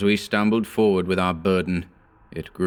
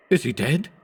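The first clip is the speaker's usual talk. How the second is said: choked hysterical question; 7 semitones higher than usual. A narrator's reading. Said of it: "I choked out the question hysterically".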